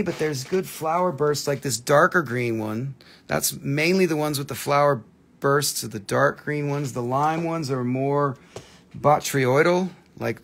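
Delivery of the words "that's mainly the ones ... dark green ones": ordinary delivery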